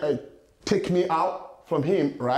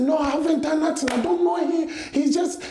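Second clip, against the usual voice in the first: pleading innocence; 7 semitones higher than usual. Retold or imitated.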